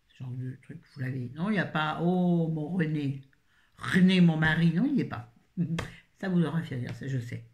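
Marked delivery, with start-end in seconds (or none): 2.0-5.3 calling out loud for René, hammed-up; mock calling husband. A pretend Québécoise calls her husband René, loudly. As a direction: playfully shout for a husband.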